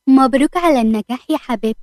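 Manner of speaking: plain reading voice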